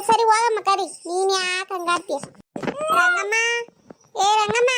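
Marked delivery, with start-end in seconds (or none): 3.0-4.7 high call of Rangamma across the yard; woman calling her neighbour at the door by name. Acted. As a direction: sing out Rangamma from outside the door.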